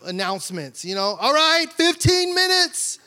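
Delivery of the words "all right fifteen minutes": high, loud call of fifteen minutes; time announcement call. Retold or imitated. Wife calling the time to the family, loud and high. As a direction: call it up the stairs like a mom timing the family. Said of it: "she kinda gives these calls, time announcements".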